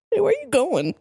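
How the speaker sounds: quick, high-pitched question; high mock call. A narrator's reading. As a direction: toss it out high without stretching it. Repeats the falling line, shorter.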